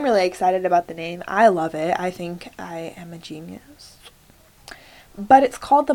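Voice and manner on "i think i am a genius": whispered aside. Real, not acted. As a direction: whisper it to yourself. Confessing proudly under breath.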